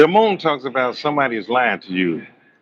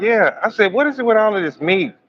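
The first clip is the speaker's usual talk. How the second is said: incredulous; 9 semitones higher than usual. Retold or imitated. Incredulous back-and-forth retold.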